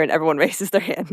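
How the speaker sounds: usual speaking voice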